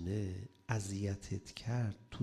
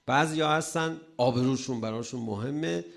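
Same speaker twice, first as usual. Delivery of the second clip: ordinary talk, nothing marked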